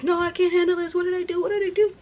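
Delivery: high, frantic imitation of a helpless manager; mock panic. Manager flustered by a crying employee, mock panic. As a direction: panic in a squeaky, put-on voice.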